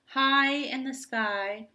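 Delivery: plain reading voice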